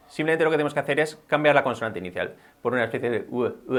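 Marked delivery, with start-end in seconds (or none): none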